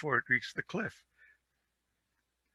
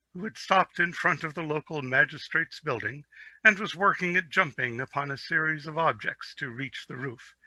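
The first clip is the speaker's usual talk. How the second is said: usual speaking voice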